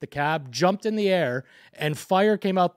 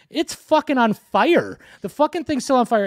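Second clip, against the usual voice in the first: retold exasperated yell; 8 semitones higher, 5 dB louder than usual. Telling the truck driver his truck is on fire, re-enacted exasperated yell. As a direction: hammer it home like the truck's burning in front of you.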